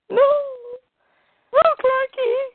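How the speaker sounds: high, loud squeal; mock Lois. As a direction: shriek it like a panicked Lois. Mock Lois crying out to Superman.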